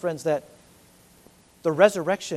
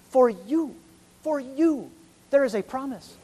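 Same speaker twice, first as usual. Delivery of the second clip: "for you" repeated, rising, higher each time; emphatic for you; 5 semitones higher than usual. Retold or imitated. Peter's promise to the crucifiers, rising emphasis. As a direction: proclaim for you with growing fervor. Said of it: "Peter can say now to you".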